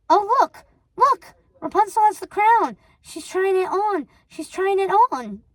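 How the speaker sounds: high, loud and eager; excited. A narrator's reading. Sister watching the mirror, thrilled and eager. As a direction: squeal it out like a giddy fan.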